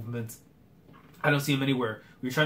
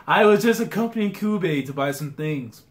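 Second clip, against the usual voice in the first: ordinary talk, nothing marked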